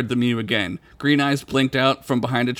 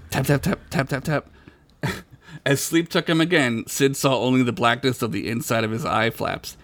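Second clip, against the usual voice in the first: ordinary talk, nothing marked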